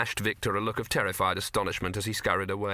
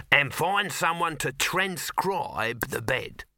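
slow, higher, emphatic order; commanding boss; 5 semitones higher, slower than usual. Acted. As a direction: spell out the order to an underling. Boss gives further order.

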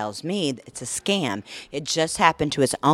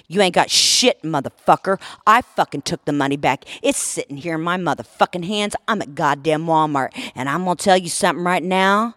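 loud, forceful retold threats; furious mama rant; 6 dB louder than usual. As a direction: lay into the scammer, punching the curses. Acting out her threatening phone rant.

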